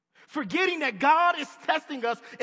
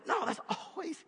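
normal talking voice

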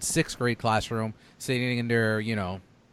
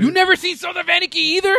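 much higher and louder, incredulous; can't believe you never saw it; shocked disbelief; 8 dB louder, faster than usual. Real, not acted. Host asking a friend who never watched, in shocked disbelief.

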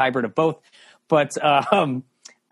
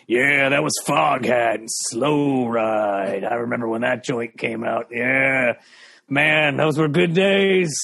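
put-on low, slow old-timer radio voice; older classic-rock DJ; 3 semitones lower, slower than usual. Young-voiced DJ trying to sound old on classic rock, drawn-out old rocker voice. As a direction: stretch it out like a nostalgic rock veteran. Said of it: "not sounding old enough".

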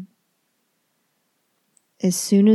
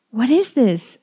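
loud, higher anguished cry; 4 semitones higher, 4 dB louder than usual. A narrator's reading. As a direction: demand it in horror. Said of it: "he cries".

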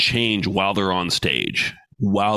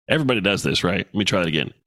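ordinary talk

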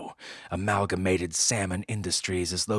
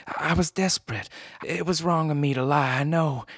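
pleading woman; 9 semitones higher, 4 dB louder than usual. Acted. Client confesses, voiced high.

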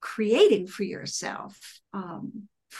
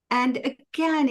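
ordinary talk, nothing marked

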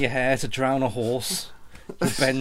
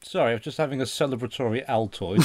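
normal talking voice

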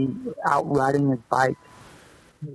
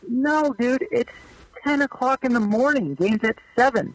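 much higher, laid-back retold correction; clubhouse manager, amused; 9 semitones higher than usual. Manager telling the rookie it is morning, much higher voice. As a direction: set the rookie straight, pitched well up. Retold locker room exchange.

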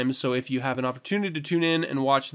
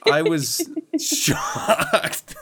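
laughing through very slow words; drawing it out in a laugh; 6 semitones higher, much slower than usual. Real, not acted. Laughing at the C word.

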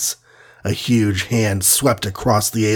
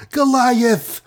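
very high, desperate screech of a name; 13 semitones higher than usual. A narrator's reading. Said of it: "screeched the Wasp again".

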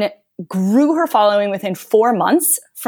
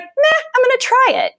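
carefree shrug. Retold or imitated. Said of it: "she was just like".

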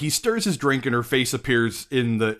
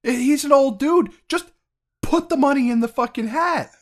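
exasperated; 10 semitones higher than usual. Retold or imitated. Continues the exasperated rant.

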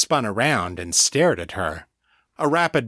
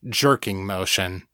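plain reading voice

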